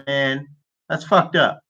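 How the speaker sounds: ordinary talk, nothing marked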